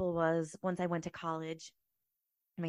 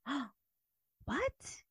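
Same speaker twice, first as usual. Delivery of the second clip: a high, startled "what?" in disbelief; stunned; 6 semitones higher, 7 dB quieter than usual. Retold or imitated. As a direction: caught completely off guard, voice jumping up. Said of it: "And I'm like".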